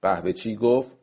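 plain reading voice

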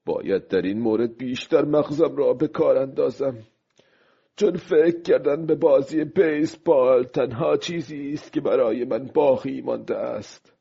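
lightly voiced fisherman thinking things over; a hint of character in pensive self-talk; musing old fisherman. Old fisherman's inner thoughts. (ماهیگیر پیر، کمی نمایشی، غرق فکر)

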